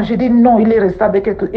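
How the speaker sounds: ordinary talk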